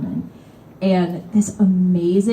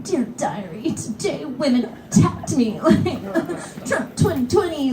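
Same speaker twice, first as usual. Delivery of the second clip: high, soft sulky diary voice; mock a sulking man recording his grievance; mocking diary whine; 7 semitones higher, 4 dB quieter, slower than usual. Seat hog dictating a voice memo, sulky mock whine.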